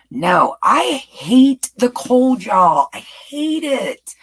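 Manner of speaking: slow, loud whine about the cold; emphatic complaint. Real, not acted. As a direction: drag it out like you truly hate it.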